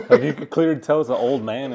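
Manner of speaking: laughing loudly through the words; can't hold back the laugh while telling it. Real, not acted. Laughing while telling fight story.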